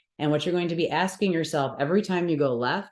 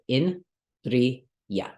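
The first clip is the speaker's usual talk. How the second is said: "in, tri, ya" as separate syllables with pauses between; slower than usual. Shown as a demonstration. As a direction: break it into "in", "tri", "ya", each on its own. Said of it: "use these rules to say".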